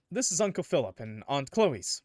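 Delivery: ordinary talk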